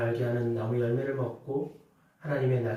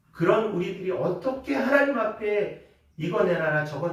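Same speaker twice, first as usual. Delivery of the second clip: mock demand; 5 semitones higher, 5 dB louder than usual. Mocking demanding prayer.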